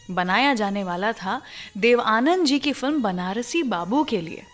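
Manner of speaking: usual speaking voice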